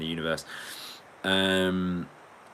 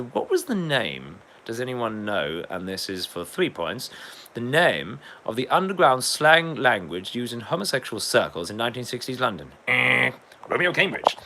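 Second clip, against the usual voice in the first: higher, measured quizmaster putting the question; mock quiz host; 4 semitones higher, 4 dB louder than usual. Streamer posing a three-point question to viewers, mock quiz host.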